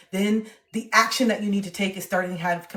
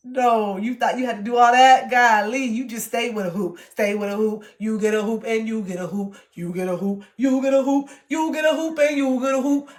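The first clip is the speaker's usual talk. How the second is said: sassy talk to self; 2 semitones higher, 5 dB louder than usual. Pretend. To her past self, mock giveaway sass. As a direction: hand out hoops like a host. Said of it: "I just look at it like".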